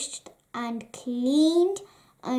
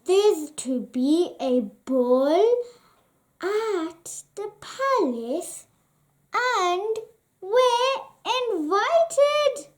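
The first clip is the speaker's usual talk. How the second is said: thrilled; 7 semitones higher than usual. A narrator's reading. Stepmother announcing the ball to her daughters, rising in glee. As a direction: build to a high excited peak. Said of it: "thrilled Cinderella's stepmother".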